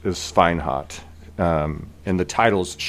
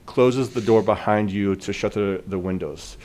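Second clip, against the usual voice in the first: ordinary talk, nothing marked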